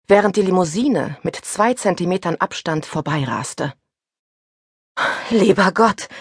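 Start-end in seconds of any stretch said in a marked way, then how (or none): 5.3-6.1 a touch of a gasped relief; lightly gasped prayer; gasping. A narrator's reading. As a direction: breathe it out in shock. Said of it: "keuchte ich".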